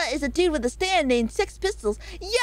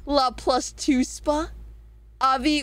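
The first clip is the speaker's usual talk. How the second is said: ordinary talk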